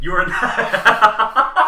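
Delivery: words dissolving into loud laughter; can't finish for laughing; cracking up at the player's new job. Real, not acted. GM cracking up mid-sentence.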